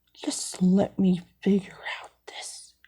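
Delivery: soft unvoiced whisper. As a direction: hush it under your breath. Whispers aside while caught.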